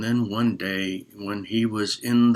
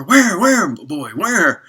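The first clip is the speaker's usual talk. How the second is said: high, loud excited questions; eager villagers; 8 semitones higher, 7 dB louder, slower than usual. Acted. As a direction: rush in eager to find the wolf. Villagers rush over searching.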